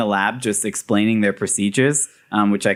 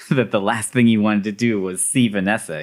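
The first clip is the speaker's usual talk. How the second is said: ordinary talk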